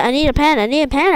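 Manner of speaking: normal talking voice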